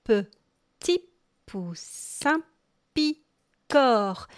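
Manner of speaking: spaced, syllable-by-syllable rhythm demo. Shown as a demonstration. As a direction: say "petit", "poussin", "picore" one by one with a pause between each. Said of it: "Donc, ça fait le".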